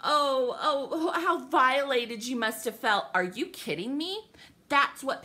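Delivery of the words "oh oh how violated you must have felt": slow, drawn-out sarcastic gushing; heavily sarcastic fake admiration; mock sympathy. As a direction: gush mockingly like a fawning commenter. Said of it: "the fucking comments on some".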